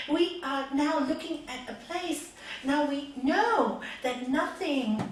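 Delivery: loud, driving list of woes; impassioned preaching. Real, not acted. Preacher rousing the crowd, loud and urgent.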